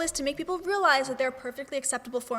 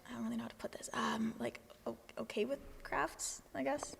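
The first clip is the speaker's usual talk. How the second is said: soft hushed whisper, tiptoeing round it; awkward whisper; 11 dB quieter than usual. Real, not acted. Host asking the guest an awkward question, whispering.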